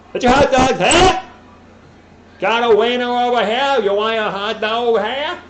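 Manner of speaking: loud, high hot dog hawker call; stadium vendor. Shown as a demonstration. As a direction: bellow it up the stands like a vendor. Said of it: "Ah, that's terrible. I suck at that".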